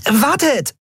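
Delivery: high-pitched call to wait. A narrator's reading. Nelen flutters after the others, calling out. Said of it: "rief Nelen".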